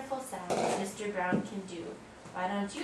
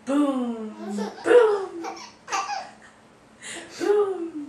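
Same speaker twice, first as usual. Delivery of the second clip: big loud booms; loud booming; 8 semitones higher, 12 dB louder, much slower than usual. Acted. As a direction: boom it out loudly. Booming sound review.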